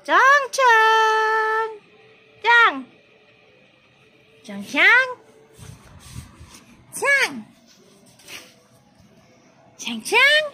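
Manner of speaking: high, loud name-calling. Real, not acted. Vlogger calling Chang to get attention, high loud call.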